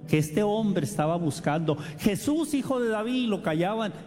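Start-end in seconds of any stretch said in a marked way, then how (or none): 2.0-3.2 high pleading call, held back in level; crying out. Retold or imitated. The blind man calling to Jesus while hushed, high call. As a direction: cry his name upward. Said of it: "él gritaba".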